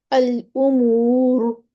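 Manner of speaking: الامور drawn out very slowly, ر thick; heavy ra recitation. Shown as a demonstration. Teacher reciting الامور to students, slow with heavy ر. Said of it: "موٹا پڑھیں گے ہم اس کو".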